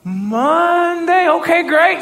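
high, stretched-out Monday, then quick acceptance; retold startled okay; surprised agreement. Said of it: "I was kinda surprised".

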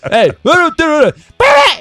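very high, loud shout from inside; a high, loud shout heard through a door; far-off yell. Retold or imitated. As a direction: yell it from the next room. Retold yell from inside house.

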